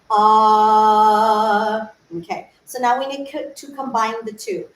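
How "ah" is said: long loud open "Ah". Shown as a demonstration. Showing students the open vowel, long loud "Ah". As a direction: hold a big open "Ah". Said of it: "an ah".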